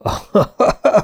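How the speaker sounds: high taunting laugh; mocking laugh. A narrator's reading. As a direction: laugh at the knight from afar. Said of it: "Arian herceg felnevetett".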